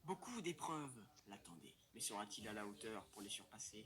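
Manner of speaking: low, dramatic narrator voice; story narration. A narrator's reading. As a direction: tell it like a documentary voiceover.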